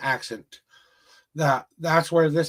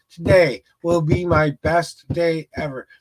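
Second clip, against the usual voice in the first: each word spaced out and a bit louder; slow affirmation; 4 dB louder, slower than usual. Shown as a demonstration. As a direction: say it like a daily mantra, slowly. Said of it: "by simply saying".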